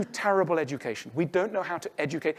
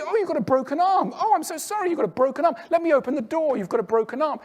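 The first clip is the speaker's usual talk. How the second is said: much higher, louder cooing sympathy; sympathetic fussing; 9 semitones higher, 3 dB louder than usual. Retold or imitated. A bystander fussing over someone with a broken arm, cooing sympathy. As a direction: gush over someone's injury. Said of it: "Everyone can see".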